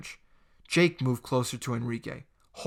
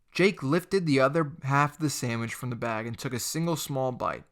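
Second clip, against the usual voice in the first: ordinary delivery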